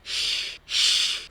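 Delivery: long unvoiced shushing "shh"; siseo de silencio. Shown as a demonstration. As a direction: hush someone with a drawn-out shh. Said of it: "cuando le dices a alguien que guarde silencio". ("shh" largo para pedir silencio)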